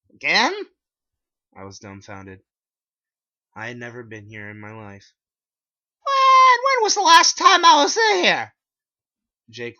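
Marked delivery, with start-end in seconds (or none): 0.1-0.5 high, loud squeak of surprise; sudden high disbelieving yelp; shocked. Acted. Narrator shocked at being recognized. 6.0-8.5 very high, loud panicked voice; frantic high-pitched demand. Acted. Narrator panicking at cashier.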